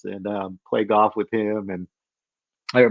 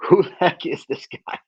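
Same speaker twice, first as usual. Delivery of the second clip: mock amazement; 7 semitones higher, 3 dB louder than usual. Audience amazed at mentor.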